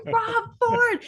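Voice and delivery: high, drawn-up exclamation of recognition; retold realization. Echoing my sister down the table, high excited realization. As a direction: echo the name way up high, acting out the penny dropping.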